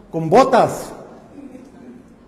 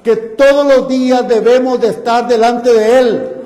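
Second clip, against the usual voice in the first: rising, louder pulpit exhortation, stretching key phrases slowly; fervent preaching; 3 semitones higher, 5 dB louder than usual. Acted. Preacher urging the congregation to seek God daily, fervent preaching. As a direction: drive it home to the congregation.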